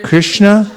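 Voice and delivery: Krishna lifted high, drawn out; the name Krishna stressed. Shown as a demonstration. Teacher presenting the name Krishna, lifted high. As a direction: sing out Krishna as the key name. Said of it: "And then Krishna".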